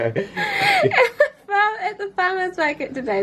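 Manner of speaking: words squeezed out through giggles; laughing while speaking. Real, not acted. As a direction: restart the sentence while still laughing. Laughing restart after tickling.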